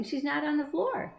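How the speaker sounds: ordinary delivery, nothing marked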